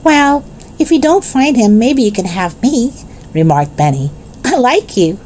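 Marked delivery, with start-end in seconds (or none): 0.0-2.9 put-on high-pitched small child offering himself; high boyish character voice; little boy's voice. A narrator's reading. Benny, a small boy, voiced. 4.4-5.2 put-on high-pitched small child; high boyish character voice; little boy's voice. A narrator's reading. Benny speaks to the man.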